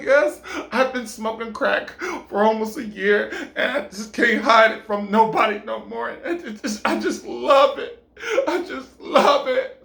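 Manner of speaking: high voice breaking into bursts; hammed-up emotional confession. Woman confesses year-long habit to him, overwrought. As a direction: gush it out, half laughing, half crying.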